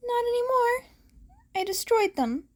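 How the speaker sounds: a hint of a nervous boy confessing; young hero. A narrator's reading.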